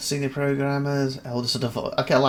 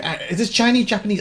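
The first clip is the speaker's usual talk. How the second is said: much higher, louder baffled wondering; confused guess; 8 semitones higher, 6 dB louder, faster than usual. Retold or imitated. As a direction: stumble over unknown scripts. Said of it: "it's like".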